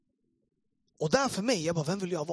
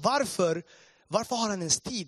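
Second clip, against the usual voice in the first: retold indignant protest, raised pitch; 5 semitones higher, 5 dB louder than usual. Listener protesting to pastor about the mocker, indignant. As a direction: demand to know why, heatedly. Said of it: "Jag blev så arg".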